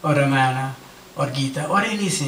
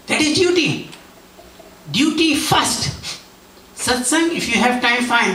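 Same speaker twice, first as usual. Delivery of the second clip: Swami scolding; 7 semitones higher than usual. Retold or imitated. Swami voiced correcting speaker.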